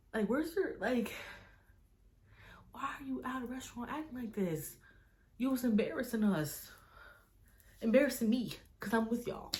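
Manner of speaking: high-pitched, indignant retold telling-off; exasperated scolding. Telling off friends for acting rude at a restaurant, exasperated.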